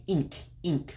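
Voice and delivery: ink with a very high I sound. Shown as a demonstration. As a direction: demonstrate the high vowel in ink. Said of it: "real, real high I sound".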